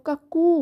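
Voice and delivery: কাকু with the final উ held long; teacher's demo of কাকু, lingering on the last vowel; drawn-out oo. Shown as a demonstration. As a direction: stretch the end of কাকু, "kuk-kooo". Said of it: "একটু উ করে আমরা একটু টানবো".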